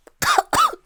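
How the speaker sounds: sudden, very high and loud choking cough; real choking cough. Real, not acted. Choking on her words mid-quote, real coughing fit. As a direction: choke mid-sentence for real. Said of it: "Not me choking".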